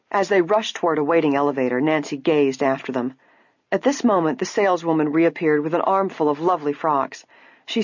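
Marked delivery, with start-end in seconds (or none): none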